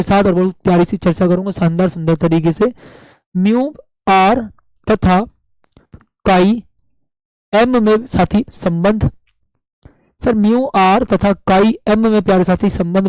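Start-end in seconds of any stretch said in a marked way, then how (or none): 3.3-9.1 each word spaced out slowly for notes; teacher dictating, one word at a time; slow dictation. Shown as a demonstration. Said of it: "बहुत प्यार के".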